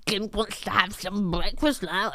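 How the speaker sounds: horrible drawn-out creature voice; slowly, as a grotty little creature. Acted. Playing a comedic persona at home, grotty creature voice. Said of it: "like, grotty creature voices".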